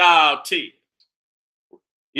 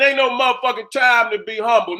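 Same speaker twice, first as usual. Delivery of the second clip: ordinary delivery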